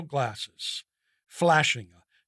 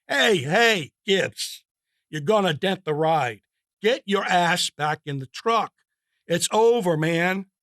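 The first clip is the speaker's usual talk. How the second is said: shouting at buddy; 3 semitones higher, 6 dB louder than usual. Acted. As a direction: yell him back into line. Wysocki restrains Gibbs.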